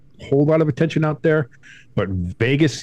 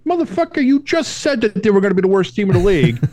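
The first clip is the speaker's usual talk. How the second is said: high, squealing mock outrage; exasperated retort; 10 semitones higher, 3 dB louder than usual. Host answers hypocritical fans, high mock outrage. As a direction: throw it back at them in disbelief. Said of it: "it was like".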